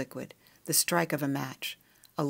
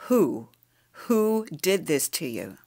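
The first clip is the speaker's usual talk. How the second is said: slow, forceful demand, mildly raised; rising to shout; 3 dB louder, slower than usual. A narrator's reading. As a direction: hit the first word hard, then press slowly. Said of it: "started in a whisper and rose to a ragged shout".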